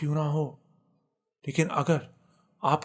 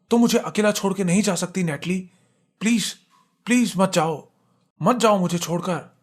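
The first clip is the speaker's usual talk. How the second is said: higher, louder, urgent pleading shout; desperate shouting; 4 semitones higher, 5 dB louder than usual. A narrator's reading. Husband begs possessed wife to fight and stay, desperate shouting. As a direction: call out to them, begging with raised voice. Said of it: "उस पे चिल्लाने लगा".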